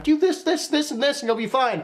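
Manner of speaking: high, sing-song mock advice voice; mocking Instagram preacher. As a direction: parody a know-it-all influencer. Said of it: "a lot of people wanna preach on their Instagram".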